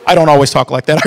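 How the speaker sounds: ordinary talk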